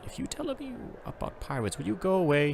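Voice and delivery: pleading, pitch jumping high; a nervous merchant begging him to leave; pleading stallholder. Acted. Alarmed merchant begging the Frenchman to leave, high anxious plea. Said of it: "he pleaded".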